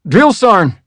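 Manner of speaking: put-on "Drill Sarn" in a Georgia southern accent, r dropped, sergeant said as Sarn. Recruit answering his drill sergeant, southern "Drill Sarn". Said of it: "That's how everybody said it in Georgia".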